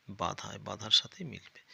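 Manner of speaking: ordinary talk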